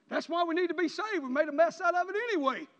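loud, high preaching shout; full-force preaching. Real, not acted. Preacher urging church to be saved, loud ringing shout. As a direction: drive it home to the congregation.

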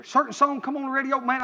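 ordinary delivery

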